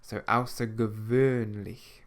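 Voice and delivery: "außergewöhnlich" slowly, the ö like the u in burn; slow "außergewöhnlich" with rounded ö; umlaut Ö sound. Shown as a demonstration. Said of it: "more like the U in the English word burn".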